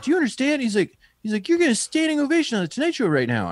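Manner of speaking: high, fast, excited host voice; imitating Jimmy's excitement. Host telling comic she's getting an ovation, high excited imitation. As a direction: squeal it up high like a thrilled host.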